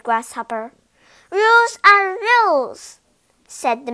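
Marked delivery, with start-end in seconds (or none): 1.3-3.0 high, loud insistent mosquito; stubborn little insect repeating the rule; bossy mosquito. A narrator's reading.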